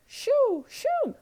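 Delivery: very high loud shoo. Acted. The hens shoo the pigs off loudly and high. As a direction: shoo them away like hens. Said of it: "said the chickens".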